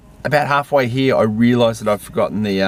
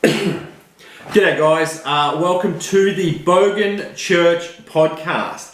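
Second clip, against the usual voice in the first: higher, slow show-opening voice; podcast host intro; 4 semitones higher, much slower than usual. Acted. Host greets listeners at the start of the first episode, drawn-out announcer intro. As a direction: open the show like a radio host. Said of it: "and I'll go".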